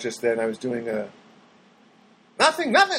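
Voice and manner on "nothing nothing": high whiny repeat of the comic's line; Bruce Smirnoff cadence. Retold or imitated. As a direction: echo the comedian's lament up high. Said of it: "doing the old, Bruce Smirnoff".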